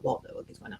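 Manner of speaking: hushed, breathy mock-whisper of shock; a hushed retold swear. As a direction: whisper it like a startled friend in the dark. Andrew's startled reaction retold.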